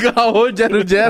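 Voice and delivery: laughing while repeating. Real, not acted. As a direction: giggle through the words.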